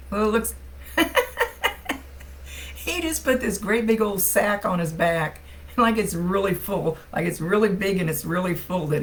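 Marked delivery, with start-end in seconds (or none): 0.6-2.7 high, airy burst of laughter; breathy giggle. Real, not acted. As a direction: giggle in delight at the image. Medium laughs at vision.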